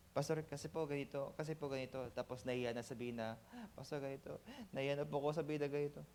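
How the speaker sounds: soft, halting, embarrassed; shy hesitant excuse. Retold or imitated. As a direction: stumble over the excuse, too shy to say it. Ashamed member hesitating to pastor. (nahihiyang pautal-utal na sagot)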